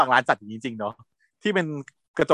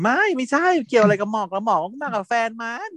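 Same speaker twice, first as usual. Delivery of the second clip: much higher squeaky denial; high-pitched voice; 10 semitones higher than usual. Retold or imitated. Friend denies caring about Mok, high-pitched voice. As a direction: push the voice up high and thin to deny it. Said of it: "เสียงแหลม".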